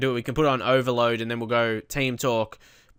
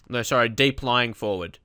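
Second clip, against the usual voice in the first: ordinary talk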